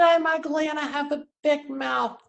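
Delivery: high mock-pouty child voice; hammed-up kid complaint, pitched high throughout; upset little kid. Son complaining to his mom after school, high imitated child voice. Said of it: "I said".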